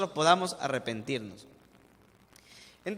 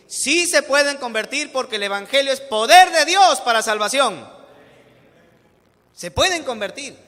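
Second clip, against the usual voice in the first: loud, higher, rising preaching; fervent preaching; 6 semitones higher, 10 dB louder than usual. Real, not acted. Pastor insisting to the church that all can be saved, fervent. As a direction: proclaim it with full fervor.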